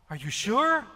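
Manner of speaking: normal talking voice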